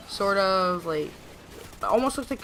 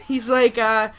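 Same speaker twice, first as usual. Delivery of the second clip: usual speaking voice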